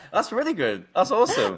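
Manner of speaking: high, loud words through real laughter; giggling praise; laughing delight. Real, not acted. Conversion result amuses both.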